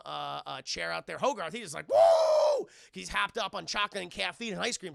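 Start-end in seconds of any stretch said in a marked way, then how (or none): 1.9-2.7 hyped whoop. Real, not acted. Hogarth hyped before jumping.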